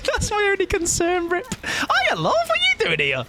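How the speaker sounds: very high sing-song hiya love; mock-sweet greeting. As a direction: chirp it like greeting an old friend. Greeting the killer mockingly.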